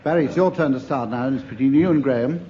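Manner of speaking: usual speaking voice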